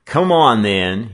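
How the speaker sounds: loud, high opening call; sharp challenge, dropping on the last word; challenging. Acted. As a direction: call him out to fight. Gang leader starts the fight.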